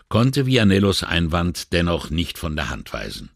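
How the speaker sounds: ordinary delivery, nothing marked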